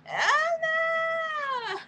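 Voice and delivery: high, hammed-up fake wail of oh no; mock crying. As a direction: wail it like a pretend sob, half laughing. Mock dismay after caller's question.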